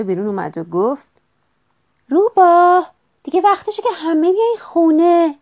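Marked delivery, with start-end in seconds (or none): 2.1-5.3 loud, high mother's call. A narrator's reading. Fox's mother calls everyone home, loud high calling. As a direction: call the children in from across the yard.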